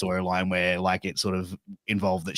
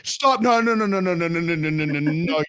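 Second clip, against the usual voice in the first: frantic stop; 8 semitones higher than usual. Retold or imitated. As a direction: stop him in a rush of noes, high and fading. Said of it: "the promoter was like".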